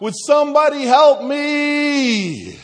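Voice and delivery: drawn-out, high, loud scream; screaming for help. Acted. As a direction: scream desperately up the cliff. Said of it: "he screamed out".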